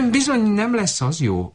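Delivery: high squeaky goose objection. Acted. Goose objecting to the shepherd girl, high and squeaky. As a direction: pipe it up like a goose.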